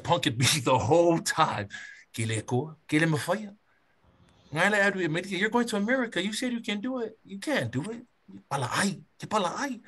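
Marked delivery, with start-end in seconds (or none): 2.1-9.8 high teasing taunts in Samoan; mocking a teasing driver, pitched up; mock taunting. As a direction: needle him like a mocking driver. Guy teasing him on the ride.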